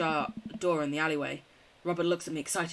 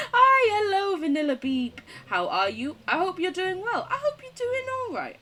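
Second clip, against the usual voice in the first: much higher, chirpy hello; bright greeting; 12 semitones higher, 4 dB louder than usual. Real, not acted. Greeting a follower in the chat with a much higher, chirpy voice. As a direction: greet them sweetly and brightly.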